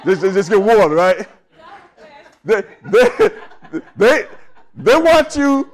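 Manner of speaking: high, loud building sermon voice with laughs; rising preacher's fervor; preacher driving the salt point home, loud and high; preaching at full force. Acted.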